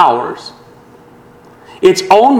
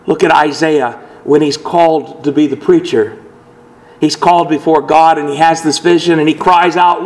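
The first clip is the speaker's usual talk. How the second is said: ordinary delivery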